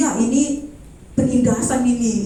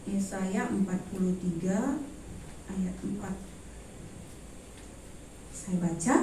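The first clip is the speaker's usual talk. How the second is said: muttered aside; 5 semitones lower, 16 dB quieter than usual. Real, not acted. Looking up verse, mumbling aside.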